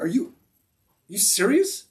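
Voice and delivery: student stunned by a dawn swim order, incredulous. Retold or imitated. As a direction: ask like you can't believe it. Guy stunned by bathing suit request.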